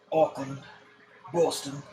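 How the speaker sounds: a playful, deliberately bad New Zealand accent on Auckland and Boston. Shown as a demonstration. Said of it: "That's my bad accent".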